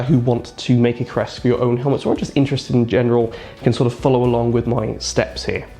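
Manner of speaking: usual speaking voice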